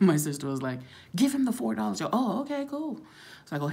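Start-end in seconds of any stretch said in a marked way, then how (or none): 1.2-2.0 higher, louder quoted urging; urging sister. Retold or imitated. Retold sister urging her. 2.2-2.9 high-pitched, easygoing quoted reply; breezy agreement. Retold or imitated. Her retold agreeable reply.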